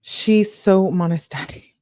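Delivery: usual speaking voice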